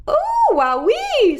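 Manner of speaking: very high, loud, slow squeak; a little bird squeaking in awe; squeaky. A narrator's reading. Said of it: "squeaked Olivia".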